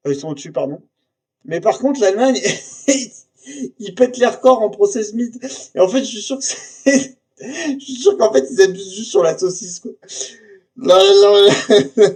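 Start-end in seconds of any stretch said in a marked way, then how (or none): 1.5-9.9 higher, louder, giggling through the words; can't hold back the laughter; laughing at Germany's sausage habit. Real, not acted. Amused by German sausage figures. 10.8-12.1 loud, high "là" with a laugh in it; jabbing at the table, cracking up; excited pointing. Real, not acted.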